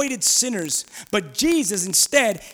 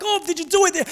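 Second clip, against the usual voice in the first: higher, lightly mocking prima donna whine; 6 semitones higher than usual. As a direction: mimic fussy, pampered priests. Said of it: "like a bunch of prima donnas".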